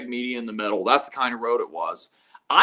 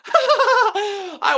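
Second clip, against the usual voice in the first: laughing through the words, slow and high; 7 dB louder than usual. Real, not acted. Admitting to viewers he faked it, laughing. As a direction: giggle as you confess it. Laughing about fooling the cop.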